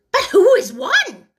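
high, loud eager question; eager crowd. A narrator's reading. The panting animals ask the Dodo, eager and loud. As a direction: call out together eagerly. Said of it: "panting and asking".

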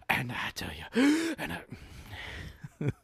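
high, slow preacher cadence with big gasps; hammed-up revival preaching; mock Southern preacher. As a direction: gulp air between preached phrases. Mock preacher, then back to normal.

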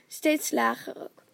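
ordinary delivery, nothing marked

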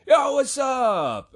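long, high shouted greeting, imitating a loud buddy; top-of-lungs scream. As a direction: holler it to the whole shop. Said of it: "screams at the top of his lungs".

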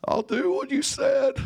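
very high, cracking cry of surrender; bawling. Retold or imitated. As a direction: sob it out, broken and sorry. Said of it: "I am bawling".

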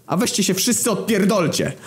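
high, bitter cartoon yell; humiliated footballer yelling at everyone, high and bitter; embittered yell. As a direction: shriek it like a wounded ego. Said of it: "wrzasnął rozgoryczony Lewandowski".

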